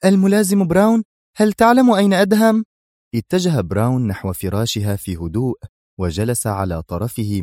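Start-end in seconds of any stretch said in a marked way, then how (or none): 0.0-2.7 high, loud burst of surprise; startled woman asking urgently; astonished. A narrator's reading. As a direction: exclaim it in amazement, pitched far up. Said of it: "وغمغمت منى في دهشة".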